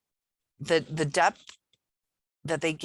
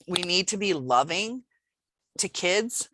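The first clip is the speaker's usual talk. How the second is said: ordinary delivery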